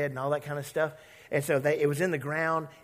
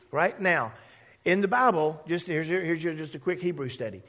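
normal talking voice

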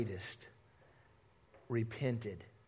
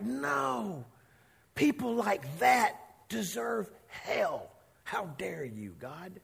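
slow, bitter outrage fading off; indignant Jonah; 4 semitones higher, 3 dB quieter, slower than usual. Retold or imitated. Angry Jonah rebukes God for sparing Nineveh, slow and bitter. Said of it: "and he became angry".

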